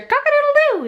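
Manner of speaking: very high, sustained rooster crow. Acted. Reader shows a child rooster sounds, rooster crow. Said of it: "He can sound like a rooster".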